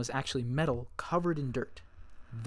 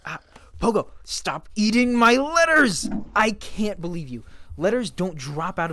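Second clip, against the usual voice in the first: much louder and higher, stretched-out scolding shout; outraged shout; 8 semitones higher, 9 dB louder than usual. Acted. Scolding Pogo for eating the letters, outraged shout. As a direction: yell at the dog caught chewing the letters. Dog chewing up her letters.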